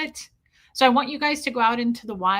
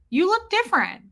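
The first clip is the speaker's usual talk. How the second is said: higher-pitched, exclaiming mimicry; retold exclamation; 10 semitones higher, 3 dB louder than usual. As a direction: imitate someone blurting it out. Retold blunt remark from officer.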